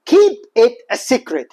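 very slow, every word drawn out; slow model phrase. Shown as a demonstration. Teacher introducing a new phrase, very slow. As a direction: spell the phrase out for the class. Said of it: "هذه عبارة".

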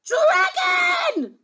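very high, loud princess yell; piercing child's shout. Acted. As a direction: yell it out greedily. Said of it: "yelled the little princess".

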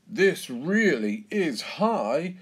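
slow, high, wavering pitch; nervous at a height. A narrator's reading. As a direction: dwell on each word uneasily, voice pushed up and loud. Scared dad on big wheel.

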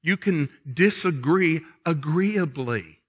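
usual speaking voice

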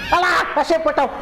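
high, loud cry of relief and joy; excited relieved yell; triumphant shout. Real, not acted. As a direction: shout it out as you spot the way out. Finds the exit after the chase.

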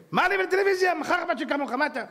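mocking retort. Mocking the TV inspector.

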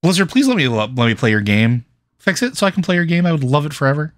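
high-pitched wishful appeal; playful pleading. Pretend. Begging the game maker to fix it.

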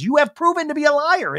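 hammed-up outraged repeat; mock heated accusation.

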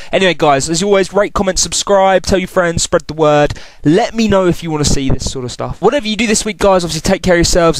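loud upbeat sign-off; bright host wrap-up to viewers; YouTuber outro. Acted.

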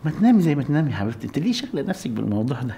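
fast, brushing it off; rushed dismissal. Acted. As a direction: hurry past the question. Husband brushing off, rushing.

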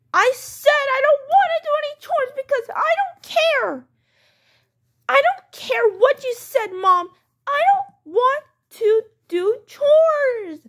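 high-pitched defiant outburst; child's tantrum. Acted. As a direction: throw a kid's fit at mom, stretching the last refusal.

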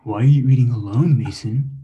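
far-off sneering alter ego voice; bully yourself in a mocking put-on voice; evil emo twin. The evil emo twin taunts Mason at snack time, sneering. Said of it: "my evil emo twin comes out to bully me".

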